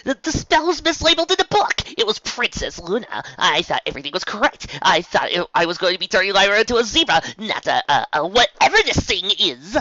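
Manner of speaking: high, loud rushed babbling; panicked excuses. Acted.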